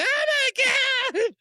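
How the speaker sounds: high loud mock shriek; imitated scream. Retelling the passenger's screaming, mock shriek. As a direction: shriek it in fake terror. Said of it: "she was screaming ninety-five percent".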